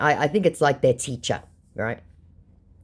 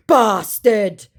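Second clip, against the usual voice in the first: raised, loud put-on insult; mock angry scammer; 7 semitones higher, 6 dB louder than usual. Scammer swearing at the baiter, mock angry. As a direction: throw it out in mock outrage.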